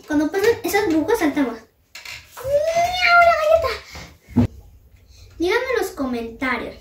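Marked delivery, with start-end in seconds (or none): 2.4-3.7 excited meow. Real, not acted. Cheering on reaching the cookie.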